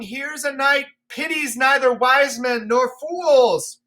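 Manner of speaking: squeaky high frightened fool. Acted. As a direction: beg the old king to come inside. The fool pleading with Lear.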